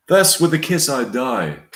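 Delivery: put-on dying stage lover in an English accent; theatrical English accent sinking at the end. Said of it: "English accent".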